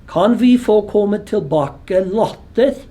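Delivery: put-on slow, careful Norwegian-accented delivery, LATER disguised as a Norwegian word. Missionary asking a Norwegian to let them come back, Norwegian accent. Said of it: "say that with the Norwegian accent".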